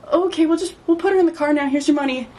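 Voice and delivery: high-pitched, awkwardly polite brush-off; uneasy placating. Retold or imitated. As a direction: imitate a nervous, let's-wrap-this-up politeness. Retold awkward exit from weird seller.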